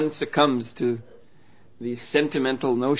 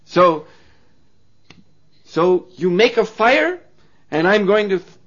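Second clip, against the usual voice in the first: defiant; 6 semitones higher, 7 dB louder than usual. Acted. Wife demanding the fire test.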